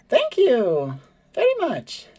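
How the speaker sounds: high, slow, strained politeness; high forced thank-you bird voice; forced polite thanks. Acted. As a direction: thank him through a forced smile. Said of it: "Her, her smile becomes a lot more forced".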